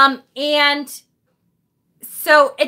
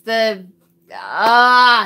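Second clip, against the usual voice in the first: ordinary delivery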